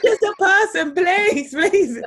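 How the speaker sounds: put-on high, loud Birmingham-accent begging; mock Brummy plea. Casting director jokingly begs actors in a Birmingham accent. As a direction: beg in a hammed-up Birmingham accent. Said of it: "in a Brummy accent".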